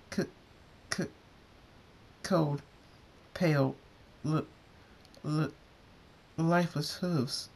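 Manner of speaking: small shaken voice, long gaps between words; frightened stammer. A narrator's reading. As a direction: stutter out each word in shock. Frightened character stammers after spell.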